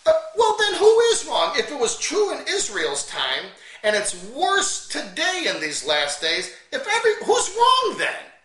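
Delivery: preacher daring his critics to answer; incredulous challenge. Acted. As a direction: throw the question back in disbelief.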